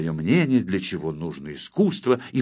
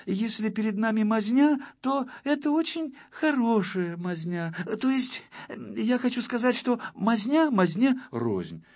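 high-pitched, hesitant pupil voice; timid; 11 semitones higher than usual. A narrator's reading. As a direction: venture a tentative, placating opinion to teacher. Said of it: "несмело сказал". (несмело, робко)

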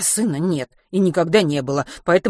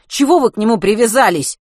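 angry shout; 6 semitones higher, 8 dB louder than usual. A narrator's reading. Matvey shouting at teacher.

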